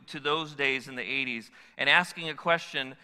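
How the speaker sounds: ordinary delivery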